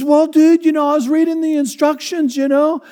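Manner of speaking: high, slow dopey surfer voice, laughing at the end; surfer dude. Retold or imitated. Surfer explaining two coats to wife, high dopey voice. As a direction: deliver the punchline dead sincere.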